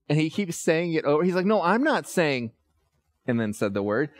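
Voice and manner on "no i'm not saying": imitating son protesting.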